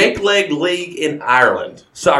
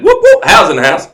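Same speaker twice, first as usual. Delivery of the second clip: loud, high whoops with a faked Irish lilt; loud mock-Irish hype call; Irish announcer catchphrase; 4 semitones higher, 7 dB louder than usual. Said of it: "I don't have a good Irish accent".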